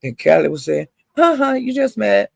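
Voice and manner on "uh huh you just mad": high, sing-song uh-huh taunt; teasing taunt. Retold or imitated. Callie teasing Royalty during the drag, high mocking taunt. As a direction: needle her lightly in a high voice. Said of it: "she was talking like that".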